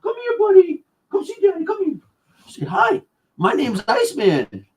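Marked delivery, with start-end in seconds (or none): 0.0-3.0 high sing-song pet voice. Real, not acted. Dad calling his dog in high baby talk. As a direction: coax the dog over in a high cooing voice.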